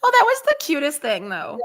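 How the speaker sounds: high, loud burst of delight; gushing delight. Real, not acted. Gushing to her co-host about the teammate's move, excited squeal. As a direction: squeal over how cute that play was.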